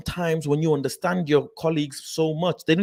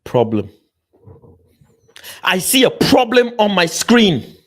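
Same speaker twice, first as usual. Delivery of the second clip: loud exclaim; 5 semitones higher, 10 dB louder than usual. Real, not acted. Shouting at the interview on screen.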